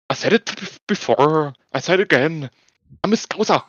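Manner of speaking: imitating a fighter crowing after a win; mock Scouse fighter boast. MMA fighter after winning the fight, cocky Scouse boast. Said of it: "blonde MMA fighter from Liverpool".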